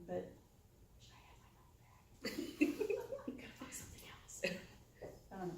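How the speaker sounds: half-laughing whisper; whispered aside. Real, not acted. To the audience, joking about her money: hushed whisper. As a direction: whisper the regret as a confidential aside. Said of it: "joking aside to audience".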